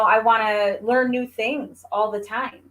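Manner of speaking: normal talking voice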